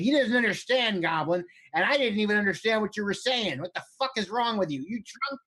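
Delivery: high-pitched goblin berating a comrade; exasperated goblin. Acted. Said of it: "He's like".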